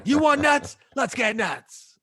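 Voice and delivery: Batman quote. Retold or imitated.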